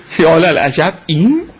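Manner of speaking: ordinary delivery, nothing marked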